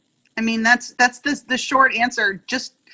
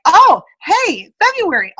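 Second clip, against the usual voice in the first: cheery greeting; 9 semitones higher, 9 dB louder, slower than usual. Retold or imitated.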